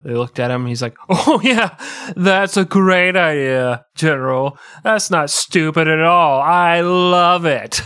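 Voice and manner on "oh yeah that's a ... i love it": sarcastic. Retold or imitated. Mocking troops' reply to general.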